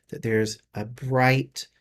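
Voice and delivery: slow, spaced-out meditation voice; guided meditation. Acted. Reader guiding listeners to picture a ball of light, slow meditation. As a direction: lead them gently through it with long pauses.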